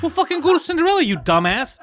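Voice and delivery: loud retort starting very high; exasperated scolding. Acted. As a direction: burst out at a dumbass, half in jest. Exasperated retort in mocking example.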